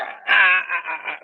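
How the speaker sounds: acted choking yell; choking cry. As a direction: cry out in broken bursts as if choked. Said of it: "So he started getting choked".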